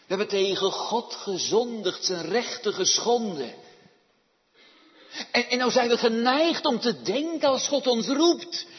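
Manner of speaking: loud, forceful pulpit voice; preaching. Real, not acted. Preacher confronts the congregation with their sin, preaching loudly.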